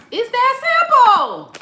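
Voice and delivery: laughing punchline. Real, not acted. Punchline, cracking up.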